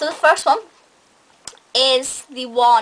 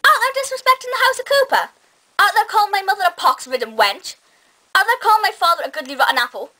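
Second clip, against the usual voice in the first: mock Lauren Cooper; 6 semitones higher than usual. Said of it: "that's what I tried to incorporate at the beginning".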